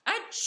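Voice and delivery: a loud, high achoo; wolf's hammed-up sneeze; big sneeze. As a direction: let a huge sneeze burst out. Wolf sneezes in story.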